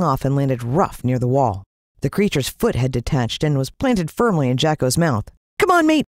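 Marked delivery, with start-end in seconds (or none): 5.6-6.0 urgent, higher and louder call; hurried. Acted. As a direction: hurry your mate along. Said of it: "I said hurriedly".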